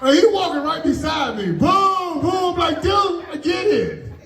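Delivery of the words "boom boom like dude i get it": high mock booing heckler. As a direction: boo him like a taunting heckler. Heckler booing beside him.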